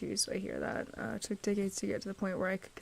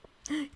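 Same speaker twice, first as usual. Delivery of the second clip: surprised gasp; 9 semitones higher than usual. Real, not acted. Spots new items in game.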